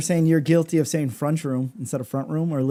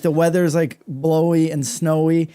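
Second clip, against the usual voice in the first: normal talking voice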